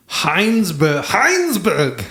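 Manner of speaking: put-on over-the-top German accent. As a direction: Heinsberg in a harsh German accent. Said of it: "I had to say it in, in a very racist German accent".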